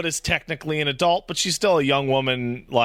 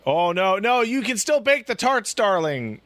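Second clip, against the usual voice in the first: put-on higher, hurried pleading girlfriend voice; pleading Chloe; 8 semitones higher than usual. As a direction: beg her not to go, pitched up. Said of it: "And she's like".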